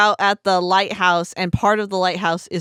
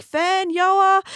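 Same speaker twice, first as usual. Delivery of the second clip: high calls, building louder; panicked calling of names; 12 semitones higher, 3 dB louder than usual. Retold or imitated. Retelling the heroine naming all the kids, panicked calling. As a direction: call out for missing children, high and urgent. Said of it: "saying all the names".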